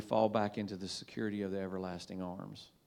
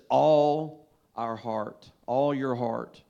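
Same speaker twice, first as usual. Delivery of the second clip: drawn out and louder, leaning on "heart"; slow emphatic quote; 8 dB louder, much slower than usual. Acted. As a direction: hammer home "All our heart" slowly and loudly. Preacher stressing the verse.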